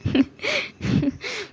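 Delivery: a high, soft laugh with tears in it; laughing through tears. Acted. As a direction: laugh while crying as you die. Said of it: "उसकी आंखों में आंसू थे और वो हंस रहा था". (आंसुओं के साथ हल्की हंसी)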